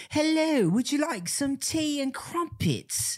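mock the stuffy English tea-party voice; posh British accent. Mocking how Americans fake a posh British accent. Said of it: "a strictly posh accent".

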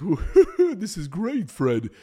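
loud, giggly cartoon sidekick; Barney voice. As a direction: cackle with delight at the smoke. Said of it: "And Barney's like".

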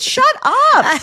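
high, loud laughing shout; exasperated squeal. Real, not acted. Telling a friend about the endless speech, high laughing yelp. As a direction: yelp it out in disbelief.

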